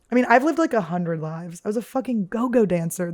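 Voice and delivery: ordinary talk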